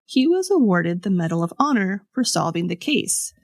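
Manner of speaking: ordinary talk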